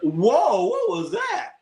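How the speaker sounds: sudden high yelp of surprise, then a question; startled whoa. Real, not acted. Streamer shocked by a play on screen, sudden high surprise. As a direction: jump up in pitch at the play. Reacting live to a game play.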